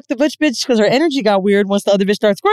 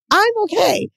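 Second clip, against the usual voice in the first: much higher-pitched mock reply; high uneasy plea; 11 semitones higher than usual. Woman asks her man to leave, high-pitched uneasy plea. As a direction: squeak it out, retelling your own plea.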